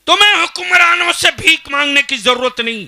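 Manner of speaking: high, full-force shout; thundering preaching. Real, not acted. Preacher telling villagers not to beg from rulers, thundering. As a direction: proclaim it at the top of your voice.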